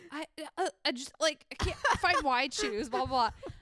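a lightly voiced high girl fumbling for words; flustered excuse. The shoplifter stammers a wild excuse to the manager, flustered. Tweaking girl's rambling excuse.

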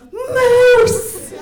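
a first-time hunter blurting out a full-voice cry of excitement; spotting a bull moose, loud excited shout. Retold or imitated. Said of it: "shouted at the top of my lungs".

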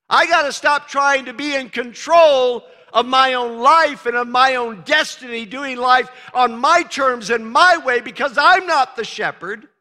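fervent sermon build; loud preaching. Acted. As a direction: drive it home to the crowd. Preaching at full force.

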